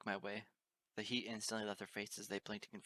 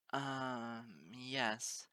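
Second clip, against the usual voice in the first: stammering. A narrator's reading. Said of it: "Twilight stammered".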